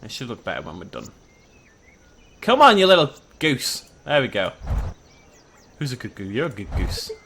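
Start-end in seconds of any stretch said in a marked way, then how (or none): 2.4-3.9 louder, urging the goose on; mild shout at goose. Real, not acted. Herding a goose, louder urging call. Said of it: "Sorry I shouted at you". 5.8-7.1 softer, cooing praise; cooing to pet. Real, not acted. Praising the goose, soft cooing pet talk.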